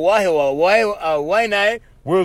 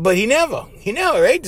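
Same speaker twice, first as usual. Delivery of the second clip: usual speaking voice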